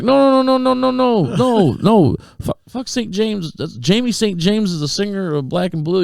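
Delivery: high-pitched pleading correction; frantic protest. Retold or imitated. Explaining the shirt to the manager, frantic high protest. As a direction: rush in with high, panicky retold denials.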